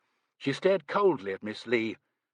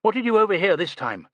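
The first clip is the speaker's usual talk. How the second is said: catty jab; 10 semitones higher, 7 dB louder, faster than usual. A narrator's reading. Catty jab at Miss Lee.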